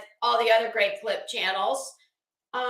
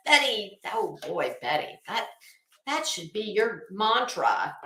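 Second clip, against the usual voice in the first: usual speaking voice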